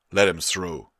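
loud command in level pitch; firm order. A narrator's reading. Guard captain telling guards to release him, loud order. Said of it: "he told the guards".

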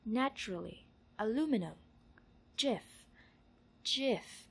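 isolated words, each with falling tune; word-list reading. Shown as a demonstration. Saying these words for an accent survey, careful list reading. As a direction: say each word separately and distinctly.

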